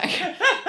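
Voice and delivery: high, loud burst of laughter; a real laugh bursting out high and loud. Real, not acted. Laughing at how the news came out, real burst of laughter. Laughing at her own story.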